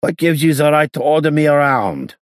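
put-on high, loud accented outburst; screamed protest. A narrator's reading. Gangster defying the inspector's orders, high screamed protest. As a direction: yell indignantly at a high pitch. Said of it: "screamed at the top of his voice".

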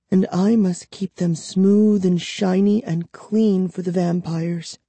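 put-on high, plaintive girl's voice; distressed captive girl. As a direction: lament the endless drudgery, pitched high.

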